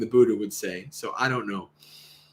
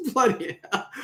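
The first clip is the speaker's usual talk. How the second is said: giggling through Bloody hell; amused echo of a startled cry; laughing repeat; 7 semitones higher than usual. Acted. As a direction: chuckle the words Bloody hell. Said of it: "you can tell this is an Aussie".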